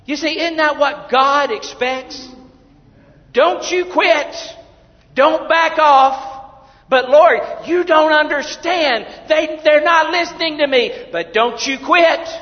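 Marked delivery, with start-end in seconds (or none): 3.3-6.3 high, loud, slow command; full-force preaching. Acted. Preacher voicing God's command loudly. 6.9-10.9 higher, louder whining protest; complaining to God. Retold or imitated. Preacher complaining to the Lord in a loud whine. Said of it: "preacher voices his complaint". 11.1-12.3 full-force preaching. Acted. Preacher voicing God's command loudly.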